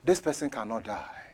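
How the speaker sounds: soft, low private prayer; praying under breath. Retold or imitated. Pastor pleading with the Lord for a member, under his breath. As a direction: keep the plea down, just for God. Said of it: "pray under my breath".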